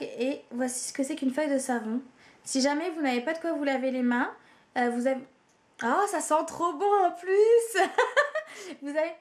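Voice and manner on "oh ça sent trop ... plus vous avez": laughing. Real, not acted. Delighted smelling soap sheet.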